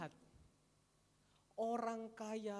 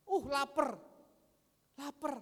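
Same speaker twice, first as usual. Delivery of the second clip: hungry Esau; 5 semitones higher, 10 dB louder than usual. Acted. Esau voiced as starving.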